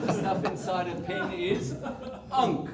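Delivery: "unk" said with a KZN accent, high and laughing. Shown as a demonstration. Coach quizzes listeners on the accent, laughing. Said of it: "get the accent right".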